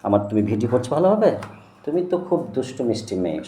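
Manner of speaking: usual speaking voice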